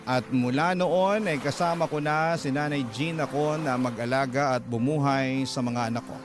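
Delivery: ordinary delivery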